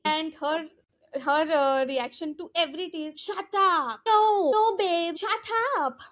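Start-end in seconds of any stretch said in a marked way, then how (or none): 3.2-6.0 high-pitched exaggerated Dubai accent; mock Dubai accent. Mimicking Tejasvi's reaction to the gossip, mock Dubai accent. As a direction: imitate a Dubai socialite's high, drawn exclamations. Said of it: "the Dubai rural accents of".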